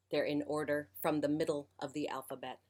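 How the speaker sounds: ordinary delivery, nothing marked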